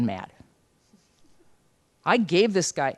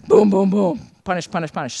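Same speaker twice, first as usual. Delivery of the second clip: thunder boom; 6 dB louder than usual. Retold or imitated. Imagined God thundering.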